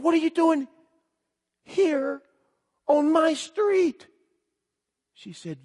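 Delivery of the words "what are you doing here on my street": high, incredulous, broken by pauses; astonished disbelief. Retold or imitated. Man finding childhood playmate on his street, astonished. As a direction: draw out the amazed question, pitched high. Retold astonishment at meeting Tina.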